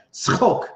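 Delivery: "Tzchok" with Hebrew ts and throaty ch; Hebrew word. Shown as a demonstration. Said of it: "as we say it in Hebrew".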